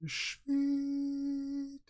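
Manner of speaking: very high, long, softish squeal; high drawn-out sweet. Real, not acted. Streamer alone, reacting in a high held squeal. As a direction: stretch the word out on one high, held note.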